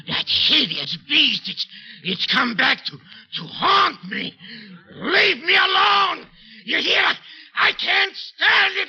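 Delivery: high, loud, slow cries of dread; terrified shouting. Acted. Man terrified of the dead cat haunting him. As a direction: scream against the haunting.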